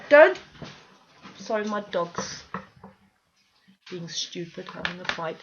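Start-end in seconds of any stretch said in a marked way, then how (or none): 0.1-0.4 sudden loud "Don't" at the dog; sharp shout at dog. Real, not acted. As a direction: snap at the dog to stop. Scolding the fighting dogs.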